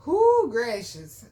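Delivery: slow, loud blowing-out whoo; relieved exhale. Real, not acted. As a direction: breathe out the memory. Exhale after painful memory.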